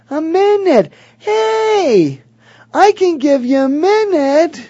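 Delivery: very high, drawn-out cartoonish falsetto; prospect's gleeful reply. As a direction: squeal it sing-song, happily agreeing. Acts out relieved prospect's reaction.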